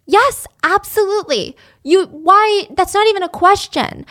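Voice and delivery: high, eager friend jumping in; imitating a friend who won't hear of no; mock-excited friend offering shelter; eager yes. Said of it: "G is like".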